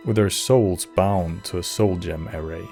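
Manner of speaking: normal talking voice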